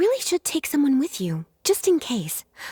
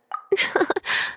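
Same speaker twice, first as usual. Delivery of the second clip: a light breathy laugh; a gentle teasing giggle; 7 semitones higher than usual. Acted. Lisa amused by compliment.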